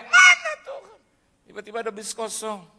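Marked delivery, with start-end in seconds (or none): 0.0-1.0 piercingly high, loud desperate shout; screaming. Retold or imitated. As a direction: scream up at God. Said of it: "ketika saya teriak".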